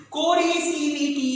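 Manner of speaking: कोरिसिविटी said slowly, every syllable stretched; drawn-out dictation. Shown as a demonstration. Teacher repeats the term for students, drawing it out slowly. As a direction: stretch out कोरिसिविटी for note-takers.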